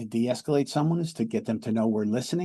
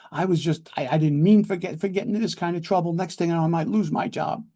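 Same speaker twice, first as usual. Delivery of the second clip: higher-pitched, mildly distressed pleading; acted upset; 6 semitones higher, 4 dB louder, faster than usual. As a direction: play someone worried and explaining themselves. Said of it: "you sound really sad".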